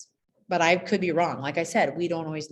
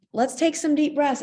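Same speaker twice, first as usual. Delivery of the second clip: raised, higher voice leading the class; bright teacher call; 9 semitones higher, 5 dB louder than usual. Retold or imitated. Teacher to kindergarten class, calling them to breathe.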